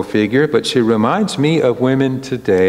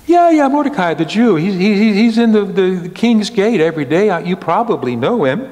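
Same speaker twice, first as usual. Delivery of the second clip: eager oblivious king; 8 semitones higher, faster than usual. Acted. Preacher voicing the oblivious king naming Mordecai, high and eager. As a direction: gush on cheerfully, tumbling over the words.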